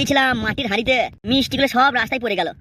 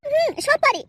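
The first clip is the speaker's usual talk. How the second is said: put-on much higher, slow girlish voice; coy young bride; 11 semitones higher, slower than usual. Bride asking the matchmaker where to sit, high coy voice. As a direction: play the shy village girl.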